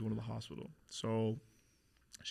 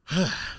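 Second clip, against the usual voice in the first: loud, heavy exhale; heavy sigh; 6 semitones higher, 10 dB louder than usual. Real, not acted. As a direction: sigh hard before a difficult story. Before the hardest part of story.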